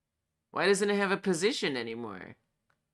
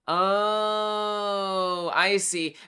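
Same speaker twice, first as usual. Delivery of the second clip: sudden realization; 4 semitones higher, 6 dB louder, much slower than usual. Real, not acted. Realizing the bug's cause.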